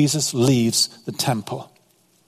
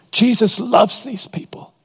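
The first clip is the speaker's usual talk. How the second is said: lifted higher, proclaiming; raised; 6 semitones higher than usual. Real, not acted. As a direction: ring it out higher. Preacher repeats line, raised.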